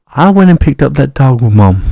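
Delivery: usual speaking voice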